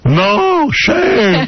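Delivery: high, drawn-out mock scream; imitated scolding yell. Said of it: "I feel like my dad".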